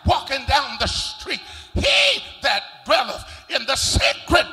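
loud, rising preacher's whoop; full-force preaching. Real, not acted. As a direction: preach it out to the congregation, building.

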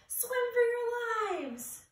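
high, loud panic cry; panicked scream. Acted. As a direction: scream at everyone to flee. Fish flee the squid.

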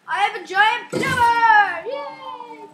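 slow, loud, gleeful cry; excited shout. Real, not acted. Player crows to friends about his new pepper. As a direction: announce the prize with a whoop.

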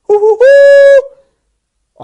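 very high, slow, loud hooting cry; high drawn-out hoots. Retold or imitated. A storyteller hooting the call of the creature, high, long and loud. Imitated sound in Igbo song-story.

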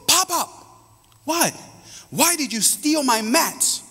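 put-on high indignant little girl; granddaughter's voice. Granddaughter accusing grandpa of stealing mats, high childish voice. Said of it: "she goes".

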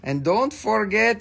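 ordinary talk

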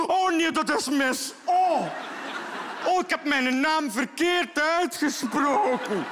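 high, slow, loud dismay. Retold or imitated. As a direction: groan over a botched take. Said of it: "is van".

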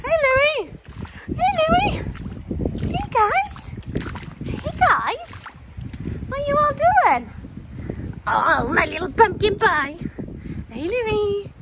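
very high sing-song greeting. Real, not acted.

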